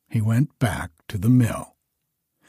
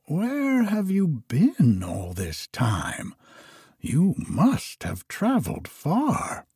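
put-on old woman; 7 semitones higher than usual. A narrator's reading. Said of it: "she said to him".

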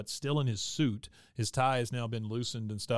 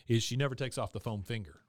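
ordinary delivery

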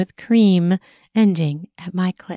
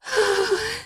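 soft sigh; 5 dB quieter than usual. Acted. Pleasure sigh.

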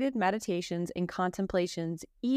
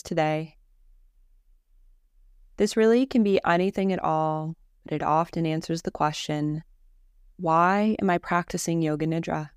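guided meditation; 3 semitones lower, 6 dB louder than usual. Real, not acted. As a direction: guide them calmly through each breath, unhurried. Leads a guided Yoga Nidra.

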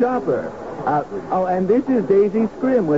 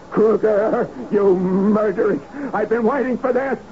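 building obsessive hatred; 5 semitones higher than usual. Acted. Clark turning on the man named Kruger, rising menacing fury. Killer confronts his fixation target.

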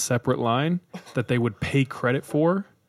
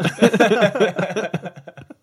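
snorting laugh; 9 semitones higher, 4 dB louder than usual. Real, not acted. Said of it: "I just snorted".

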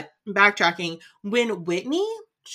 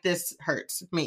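softer, slow mock wounded; mock hurt; 3 semitones lower, 8 dB quieter, slower than usual. Cohost reacting to Jen's line, soft fake hurt.

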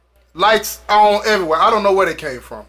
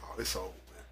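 much softer and lower, giving up; hushed despair; 14 semitones lower, 23 dB quieter than usual. Retold or imitated. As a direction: breathe it out like all hope is gone. Sees blood under the door.

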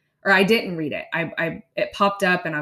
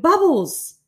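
squealed high and loud with delight; excited child; 11 semitones higher, 8 dB louder than usual. Retold or imitated. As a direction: burst out like a thrilled little girl. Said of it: "with eyes wide and pure excitement".

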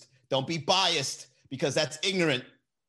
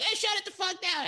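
mock yell. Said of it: "while I'm here like".

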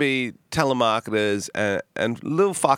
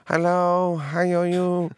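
high sing-song put-on Chinese accent; higher-pitched fake Chinese-accent greeting; 8 semitones higher, slower than usual. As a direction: answer the phone in a mock Chinese accent. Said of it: "Full Chinese accent back?".

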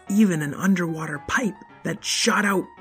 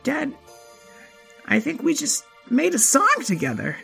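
put-on kid voice; 12 semitones higher than usual. Child voice.

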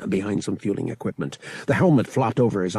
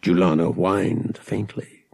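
ordinary delivery, nothing marked

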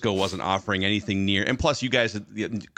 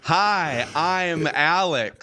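higher, slow hello, as if to a deaf man; mock shouted greeting; 6 semitones higher, 3 dB louder, much slower than usual. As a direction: spell out the greeting loudly and slowly. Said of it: "Hey, Rick".

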